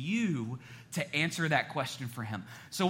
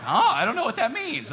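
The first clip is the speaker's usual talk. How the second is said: high-pitched baffled mimicry; exaggerated, high confused reply; mock bewilderment; 9 semitones higher, 6 dB louder than usual. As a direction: imitate a baffled listener, pitched way up. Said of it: "I know you're like".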